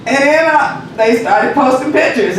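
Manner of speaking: usual speaking voice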